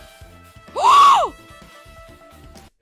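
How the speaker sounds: very high, loud gasping scream; shocked shriek. Real, not acted. Streamer finding Klutzy trapped in a cage, shocked shriek. Shocked by caged pet crab.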